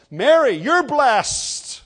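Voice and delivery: loud, high, drawn-out proclamation; loud declaration. Retold or imitated. Elizabeth, filled with the Spirit, greets Mary in a loud voice. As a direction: call it out boldly and slowly. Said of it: "she speaks with a loud voice".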